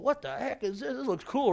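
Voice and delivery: soft, high-pitched mock amazement; retold amazement. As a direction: softly voice an impressed double take. Retelling his reaction at the movies.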